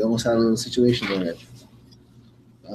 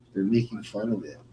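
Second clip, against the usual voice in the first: usual speaking voice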